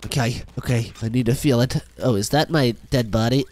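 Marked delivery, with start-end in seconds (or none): none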